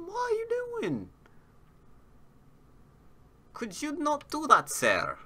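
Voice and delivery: sudden high, indignant put-on accent; mock-posh scolding voice. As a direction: scold the opponent in a silly foreign accent. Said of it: "Don't know what accent that was".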